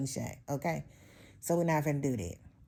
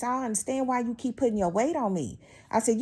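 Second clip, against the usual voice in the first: ordinary delivery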